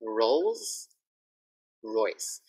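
"Rolls Royce" with a strong curled R; R drill. Shown as a demonstration. Teacher drilling R for Thai learners. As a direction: drill the R carefully. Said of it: "it has an R, it has an L".

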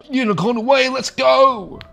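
excited shout. Real, not acted. Charging off on a unicorn.

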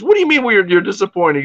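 high-pitched retold challenge; indignant disbelief. Pub man challenging a disappointed customer, indignant disbelief.

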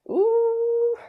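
high, loud "ooh" of delight; delighted squeal. Real, not acted. Vlogger seeing the eyelashes she got, delighted squeal. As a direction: coo loudly, way up high.

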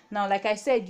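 usual speaking voice

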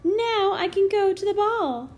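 high, loud happy cheer; cheering Cinderella. A narrator's reading. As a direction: cheer with delight. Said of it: "cheered Cinderella".

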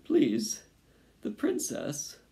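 loud plea falling to high and thin; lightly acted tearful pleading. A narrator's reading. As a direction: beg as a crying mouse. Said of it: "and he was crying now".

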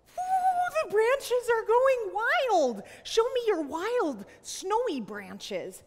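high, loud whoop swooping like wind; wild snowstorm. Pretend. Teacher tells children their branches are going wild, excited whoop. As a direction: whip up a playful storm.

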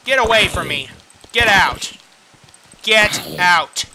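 loud shoo-away commands; angry shooing. Real, not acted. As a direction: drive it off, annoyed.

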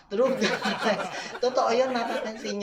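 amused, laughing as the words come out. Real, not acted. Vlogger confirming to viewers it really happens, laughing. Laughs while agreeing about accent.

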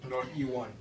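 ordinary talk

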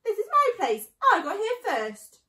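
high, loud indignant claim; indignant protest. Acted. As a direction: stand your ground, loud and shrill-pitched. Indignant rat stands his ground.

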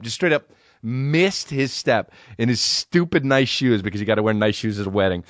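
normal talking voice

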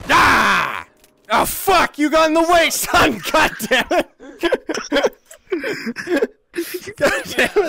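high, loud angry yelling; frustrated shouting. Real, not acted. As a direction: yell in outrage at a blocked shot. Teammate blocks his shot.

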